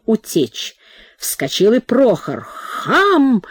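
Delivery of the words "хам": put-on high accented roar; a mild mock roar. A narrator's reading. Cook roars at Prokhor before fleeing, accented. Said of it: "взревел мистер Кук".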